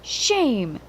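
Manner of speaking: plain reading voice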